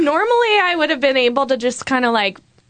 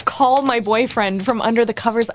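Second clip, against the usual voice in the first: ordinary talk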